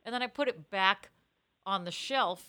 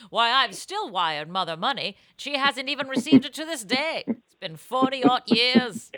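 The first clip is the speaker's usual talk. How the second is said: put-on old-timer voice; 4 semitones higher, 7 dB louder than usual.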